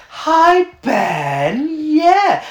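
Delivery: high, sing-song greeting; chirpy high reply; high-pitched. Retold or imitated. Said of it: "And she's like".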